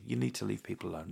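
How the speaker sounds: normal talking voice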